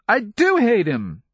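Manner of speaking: put-on loud, very high boyish wail; bawling. A narrator's reading. Boy insisting he hates his uncle, bawling. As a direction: bawl it in a child's high voice. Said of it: "he bawled".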